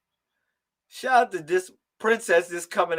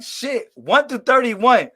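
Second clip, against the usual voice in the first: exasperated; 5 semitones higher, 7 dB louder, slower than usual. Retold or imitated. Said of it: "I be like".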